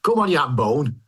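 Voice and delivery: put-on regional British accent; lightly hammed regional British accent; regional British dialect. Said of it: "reading it in the regional dialects".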